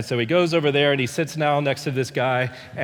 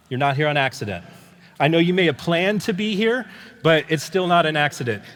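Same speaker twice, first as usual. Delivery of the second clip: loud, driving sermon delivery, pitched up; full-force preaching; 4 semitones higher, 5 dB louder than usual. Real, not acted. Pastor to congregation about the plane story, preaching loudly. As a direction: preach it out to the back row.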